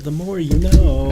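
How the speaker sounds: loud, high, drawn-out catchphrase; TV slogan imitation. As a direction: belt out the slogan slowly like a TV tagline.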